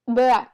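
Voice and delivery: a playful barf noise; quick gross-out gag noise; mock retching sound. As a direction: fake throw-up sound. Imitating barfing.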